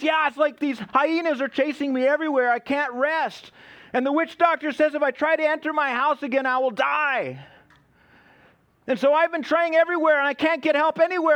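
high, loud desperate plea; acted panic, pitched well up; frantic desperation. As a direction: voice a hounded woman pouring out her fear. Hounded woman pours out her terror.

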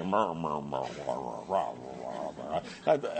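low, grumbling murmur, slow and mumbled; murmuring sound. Shown as a demonstration. Pastor demonstrating the word murmur, low grumbling mumble. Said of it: "it sounds like exactly what it is".

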